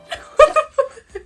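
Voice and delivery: high, loud burst of laughter; real high-pitched cackle; laughing. Real, not acted. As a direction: crack up helplessly. Cracking up at the story.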